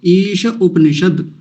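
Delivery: ईश Upanishad said slowly, each part apart; slow split into parts. Shown as a demonstration. Teacher splitting the name for students, slowly. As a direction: break ईश Upanishad into its two words slowly. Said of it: "Ishopanishad भी कहलाता है".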